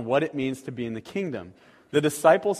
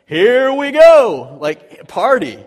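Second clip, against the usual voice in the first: high, loud, slow drawn-out cheer; party cheer; 8 semitones higher, 14 dB louder, much slower than usual. Pretend. Disciples cheering at Jesus's plan to feed crowd, party cheer. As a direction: whoop like the party's starting. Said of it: "disciples would just be like".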